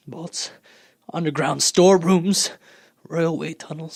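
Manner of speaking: ordinary talk, nothing marked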